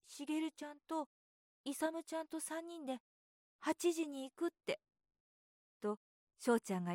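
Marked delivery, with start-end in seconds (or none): 0.1-4.8 put-on higher boyish voice, worried; a child regretting his promise; fretting little boy. A narrator's reading. Boy frets aloud about his fishing promise, higher and worried.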